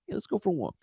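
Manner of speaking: soft, casual invitation; mild quiet mobster calm; sweetly inviting you for a walk; calm mobster invite. Retold or imitated. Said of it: "they go".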